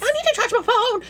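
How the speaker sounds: high frantic mom voice. Retold or imitated. Mother frantic at the campsite, high squeaky voice.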